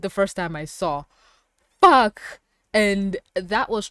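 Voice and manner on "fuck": loud higher swear; shocked curse. Real, not acted. Reacting to the injury on screen, loud shocked curse. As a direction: spit out a stunned curse.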